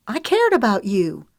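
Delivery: a touch of shaking rage; trembling with fury. A narrator's reading. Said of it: "her voice trembling with fury".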